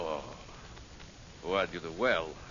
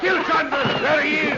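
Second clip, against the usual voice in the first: loud, higher kill order; furious shout; 9 semitones higher, 5 dB louder than usual. Acted. Villain orders his men to kill both, furious shout. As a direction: roar the order across the room.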